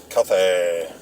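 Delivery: "Cathay" said as KAH-thay, with a th for the s; mispronounced Cathay. Retold or imitated. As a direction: say "Cathay" with a th sound and a flat ending. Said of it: "Joe used to say".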